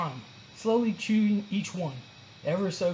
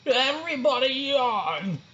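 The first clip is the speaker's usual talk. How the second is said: higher, slow drawling teacher voice; sleepy teacher; 7 semitones higher, slower than usual. A narrator's reading. Teacher giving sloths a lesson, lazy drawl. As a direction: drag the words out lazily.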